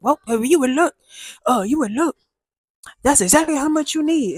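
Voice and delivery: butter her up like a sly salesman; smooth-talking dealer. Retold or imitated. Dealer flattering the buyer about her money, high oily sweet talk. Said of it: "He was like".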